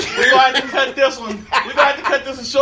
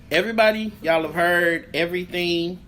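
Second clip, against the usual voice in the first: ordinary talk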